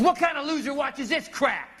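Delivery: acted-out disgusted complaint; scornful girlfriend. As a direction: imitate her sneering outburst. Girlfriend's retold outburst at Christmas.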